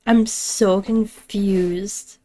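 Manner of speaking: very slow, stretched-out I'm so confused; slowly, truly lost; drawn-out confusion. Real, not acted. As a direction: let it drag out in bewilderment. Slow puzzled reaction.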